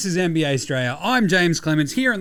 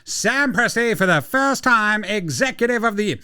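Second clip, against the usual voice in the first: race caller; 8 semitones higher, faster than usual. Shown as a demonstration. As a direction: call it like a horse race. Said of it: "Sounding like a race caller".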